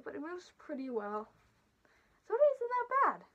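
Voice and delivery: muffled inside costume head; speaking inside enclosed mask; through a mascot head. Real, not acted. Seen 0: Full fursuit head covers the speaker's whole head while speaking.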